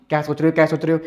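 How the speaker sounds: low monotone mimicry; droning mock repetition; mocking parroting. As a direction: parrot the nagging question in a dropped voice. Parroting her question mockingly.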